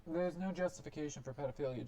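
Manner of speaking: ordinary talk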